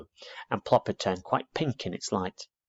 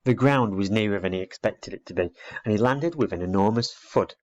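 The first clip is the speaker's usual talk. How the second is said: usual speaking voice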